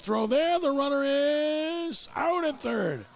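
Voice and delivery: high, rising call with a drawn-out shouted peak; excited play-by-play. Real, not acted. Announcer calls a pickoff play at third, excited play-by-play. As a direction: build to a big call on the out.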